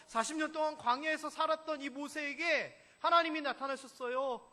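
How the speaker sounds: ordinary talk, nothing marked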